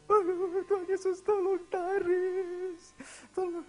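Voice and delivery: very high, drawn-out loud wail; child screaming for help. Retold or imitated. Beaten boy calling on Jesus, wailing scream. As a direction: scream the plea, stretching each word. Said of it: "dia teriak".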